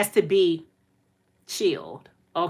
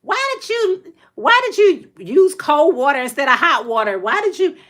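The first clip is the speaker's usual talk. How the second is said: high-pitched mock shriek of a scolding mother; retold scream, pitched up, not full volume; mom screaming; 6 semitones higher, 3 dB louder than usual. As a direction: imitate mom screeching her questions. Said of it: "My mom was screaming on the outside".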